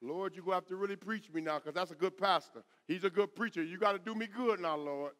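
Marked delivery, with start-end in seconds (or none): none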